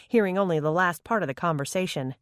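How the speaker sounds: ordinary delivery, nothing marked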